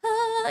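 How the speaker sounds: high, hushed worshipful "Ah"; mock rapturous sigh. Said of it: "you go to church and everyone's, like, feeling so".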